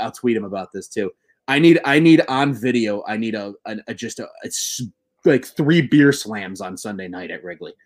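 ordinary talk, nothing marked

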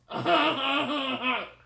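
loud, high staccato mock laugh; crazy voice demo. Shown as a demonstration. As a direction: show off a silly high cackle. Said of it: "I can only do this".

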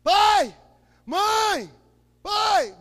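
high, loud calls to parents; retold excited shouting. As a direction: yell for mom and dad bursting in. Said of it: "entrei em casa gritando".

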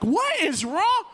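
high, slow loud plea; pleading. Retold or imitated. Dad pleading with sick son, high and slow. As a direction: drag out the desperate question. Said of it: "I was like".

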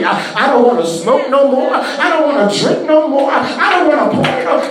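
loud, rising preacher's cry, building; preaching at full force. Acted. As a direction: shout the freedom out to the church.

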